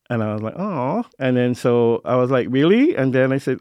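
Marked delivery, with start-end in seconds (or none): 0.6-1.1 surprised oh. Retold or imitated. Reacting to parents' answer. 2.5-3.0 high, loud rising disbelief; mock shock, voice jumping up high and loud; incredulous really. Son reacting to family already knowing, incredulous.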